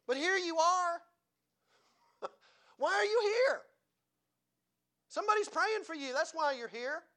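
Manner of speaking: high, loud rhetorical challenge; preaching. Real, not acted. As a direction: fire it at the congregation. Preaching at full force.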